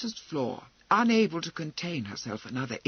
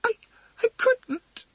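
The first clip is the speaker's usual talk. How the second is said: high, faltering refusal; horrified; 5 dB louder than usual. Acted. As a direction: shrink from it in horror. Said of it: "horror in her voice".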